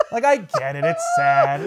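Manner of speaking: high laughing crack. Real, not acted. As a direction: laugh while saying it. Laughing through mock sadness.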